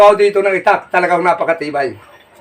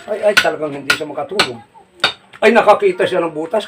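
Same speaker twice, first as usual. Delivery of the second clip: ordinary talk, nothing marked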